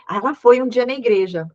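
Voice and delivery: normal talking voice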